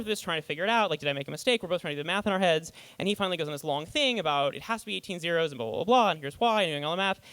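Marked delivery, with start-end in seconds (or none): none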